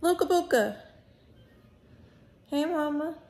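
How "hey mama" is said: ordinary talk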